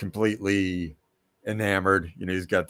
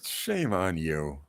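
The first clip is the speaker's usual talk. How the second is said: mock shame; 5 semitones lower, 6 dB quieter, slower than usual. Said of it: "mock scolding the cohost".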